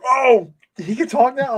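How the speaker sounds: high, slow amazed gasp of a quote; mock surprise. Retelling the movie moment, high slow amazement. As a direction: replay the shock of hearing him talk. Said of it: "I was like".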